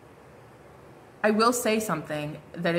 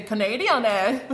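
quick mock Canadian accent, leaning on eh; 3 dB louder than usual. Reader quoting her spirit guide in a mock Canadian accent. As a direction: toss off a playful Canadian eh. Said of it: "I literally heard my spirit guy say".

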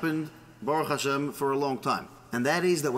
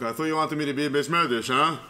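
mild mock yell, hurried and put-upon; yelling back; 3 semitones lower, 3 dB louder, faster than usual. Husband answers his screaming wife, half-hearted yell. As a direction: call back with mild annoyance. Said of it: "And he yells back".

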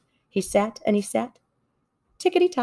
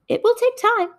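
fast-talking salesman. Acted. Salesman fox pitching.

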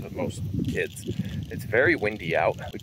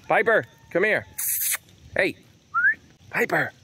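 high, raised call to the dog; 10 semitones higher than usual. Real, not acted. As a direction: summon the dog back. Calling the dog again.

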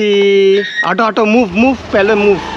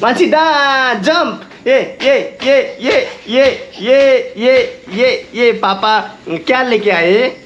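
high, bouncy cheering; cheering her on; 4 semitones higher, much slower than usual. Real, not acted. Dad cheering his dancing daughter, high and playful.

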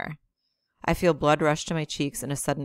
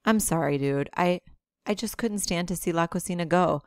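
usual speaking voice

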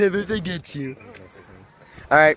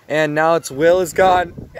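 ordinary talk, nothing marked

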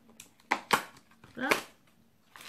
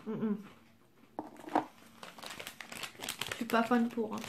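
talking while chewing; muffled hums through closed lips; mouth full, chewing; 5 dB quieter, faster than usual. Real, not acted. Seen 3: Bites snack at -3, chews with closed mouth while speaking.

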